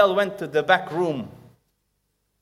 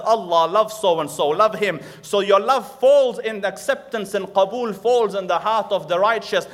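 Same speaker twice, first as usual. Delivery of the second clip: louder, higher, rousing sermon climax; full-force preaching; 5 semitones higher, 5 dB louder than usual. Acted. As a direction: preach it out to the whole hall.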